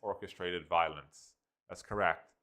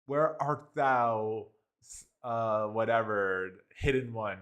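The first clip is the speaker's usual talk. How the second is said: drawn out like a stage actor; mock Shakespearean; 2 semitones higher, 3 dB louder, slower than usual. Mocking the AI's stiff Shakespearean lines. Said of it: "too Shakespearean for me".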